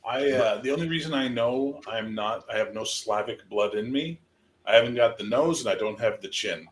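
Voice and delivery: ordinary talk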